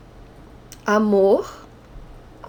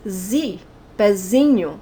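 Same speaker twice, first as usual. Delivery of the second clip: "pezinho" stressed on the syllable "zi"; 2 semitones lower than usual. Shown as a demonstration. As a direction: let "zi" carry the weight in "pezinho", not "pe". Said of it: "pé is not the strong syllable".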